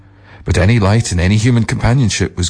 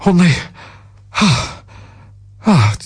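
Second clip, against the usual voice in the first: breathless gasps; panting; 11 semitones higher than usual. A narrator's reading. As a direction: gasp between words. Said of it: "panted the other".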